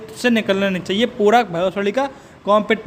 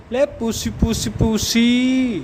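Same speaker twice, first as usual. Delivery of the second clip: slow, drawn-out call to a kitty; mock-sweet pussy cat call; coaxing a cat; 2 semitones higher, much slower than usual. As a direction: coax the kitty closer, slowly. Calling a cat.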